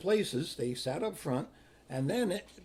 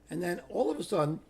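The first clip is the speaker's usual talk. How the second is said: ordinary talk, nothing marked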